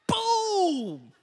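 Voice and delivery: high, loud "Boom!" burst; impact shout. Retold or imitated. Pastor acting out the helmet punch, shouting. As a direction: shout it like the hit landing. Stiff-arm hit to the helmet.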